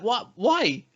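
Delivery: high-pitched, incredulous questioning; baffled disbelief. Retold or imitated. Podcaster reacting to people forgetting the night, baffled. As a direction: ask as if it makes no sense.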